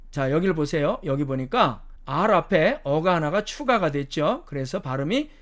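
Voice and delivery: ordinary talk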